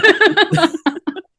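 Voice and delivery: high squealing laugh; burst of laughter. Real, not acted. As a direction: shriek with laughter. Group laughing at riled friend.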